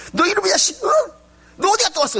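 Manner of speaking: high, scolding shout at a naughty son; angry scolding. Retold or imitated. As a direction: yell at the kid who ran off to the arcade. Angry mother scolds child. (화난 엄마가 아이를 꾸짖듯 높게 소리치며)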